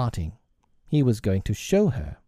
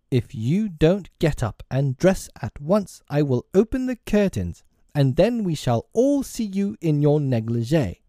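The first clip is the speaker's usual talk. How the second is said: high, deliberate warning; stern; 6 semitones higher than usual. A narrator's reading. Peter threatening to open Tink's curtains, stern. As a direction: threaten her sternly, pitched up. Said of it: "Peter went sternly toward".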